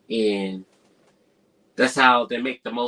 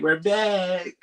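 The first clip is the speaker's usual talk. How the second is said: high, raised sing-out of an announcement; mock villains' return; 9 semitones higher than usual. Burglars announce their return to Kevin, mock high-pitched announcement. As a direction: play the returning crooks, pitched well up.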